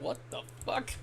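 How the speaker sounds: whispered in disbelief; hushed shocked whisper; stunned whisper. Retold or imitated. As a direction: breathe it out shocked. Crowd stunned by insult.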